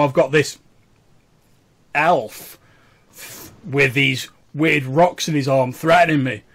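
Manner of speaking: flustered common man protesting his innocence; slightly common voice. Acted. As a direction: stumble over excuses in a common voice. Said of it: "a sort of slightly common voice".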